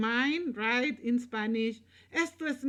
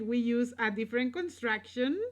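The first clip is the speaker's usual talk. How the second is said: ordinary talk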